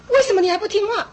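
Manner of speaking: 高声怒问孩子为何不听; 像气坏的家长那样质问; 带着愤恨的责骂. Retold or imitated. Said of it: "你要带着那个愤恨的那个心". (愤恨地质问孩子)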